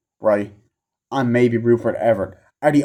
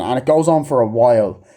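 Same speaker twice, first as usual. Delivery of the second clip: ordinary talk, nothing marked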